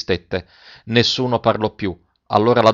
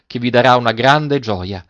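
ordinary delivery

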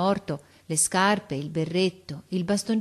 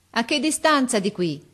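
raised, higher officer's voice; called-out question; 6 semitones higher, 4 dB louder than usual. A narrator's reading. Officer questions the lookout, calling up. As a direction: ask it across a distance.

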